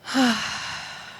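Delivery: big, audible sighing exhale; deep breath out. Shown as a demonstration. Host leading the room in a deep breath, big sigh. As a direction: let a deep breath go in one long sigh. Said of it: "take a very big, deep breath".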